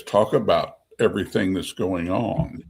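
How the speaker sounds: ordinary delivery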